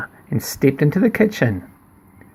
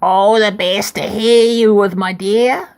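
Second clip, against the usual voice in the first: lightly voiced granny wolf; 7 semitones higher, 3 dB louder than usual. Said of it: "said the wolf".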